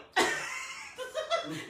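high breathy giggle; high airy laugh. Real, not acted. Laughing as the session starts, high breathy giggle.

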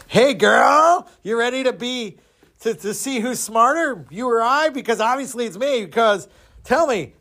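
high-pitched, showy challenge; cocky taunt. Acted. Boastful Don challenges the second sister, cocky taunt.

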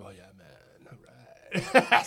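a lightly voiced, slow, low surfer drawl; laid-back surfer dude; surfer chill. Imitating his friend Leon as a laid-back surfer. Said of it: "he had that surfer chill".

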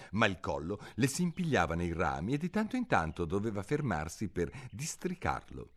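plain reading voice

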